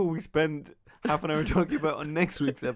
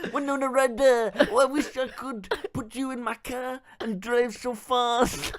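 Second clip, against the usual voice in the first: mock swooning Depp; 6 semitones higher, 4 dB louder than usual. Depp wooing Winona.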